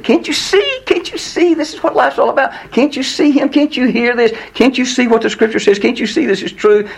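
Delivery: high-pitched, insistent exasperated plea; frustrated pleading. Acted. Pleading with unbelieving loved ones, high and frustrated. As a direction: beg them over and over, pitched high. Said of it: "have you ever been frustrated like that".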